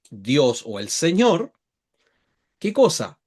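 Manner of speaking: ordinary talk, nothing marked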